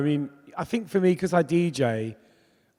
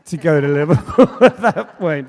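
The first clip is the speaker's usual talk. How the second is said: laughing; 3 semitones higher, 11 dB louder than usual. Real, not acted. Laughing at own story.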